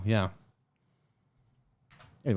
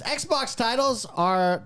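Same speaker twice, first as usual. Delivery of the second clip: snobby voice; 8 semitones higher, much slower than usual. Retold or imitated. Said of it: "read it in the voice that I picture him in".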